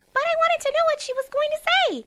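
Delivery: high, loud whine; high pouting whine; whiny Pinkie. A narrator's reading. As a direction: whine like a disappointed child. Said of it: "Pinkie whined".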